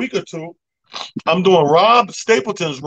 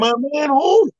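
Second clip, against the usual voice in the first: surprised; 11 semitones higher, slower than usual. Retold or imitated. Confused reply to the bouncer.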